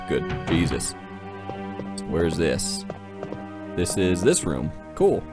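ordinary delivery

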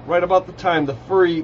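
plain reading voice

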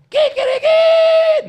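very high, slow, drawn-out falsetto squeal; mock rooster call; comic squeal. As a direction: stretch it like a comic rooster cry. Comic voice repeated.

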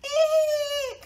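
very high, loud shriek of fright; drawn-out piercing squeal; loud mouse squeal. A narrator's reading. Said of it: "squealed Mouse".

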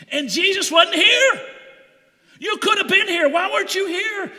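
high, loud, grieving accusation; anguished cry of why weren't you here; acted angry yell. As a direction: shout it at him like a sister whose brother just died. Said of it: "I believe she's yelling".

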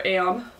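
"am" as a drawled Texan letter name; Texan pronunciation. Retold or imitated. Quoting dad reciting the alphabet, "am" drawled Texan-style. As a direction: draw "am" out like a Texan. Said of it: "until he gets to the letter".

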